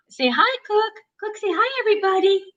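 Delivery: high sing-song coaxing. Real, not acted. Coaxing dog to greet viewers.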